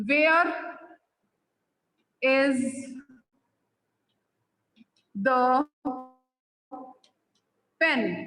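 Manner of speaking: Where, is, the, pen spaced out with long pauses; slow dictation. Shown as a demonstration. Teacher dictating an example question to students, word by word. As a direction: drop each word separately for note-taking. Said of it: "अगर suppose मैं बोलती हूँ".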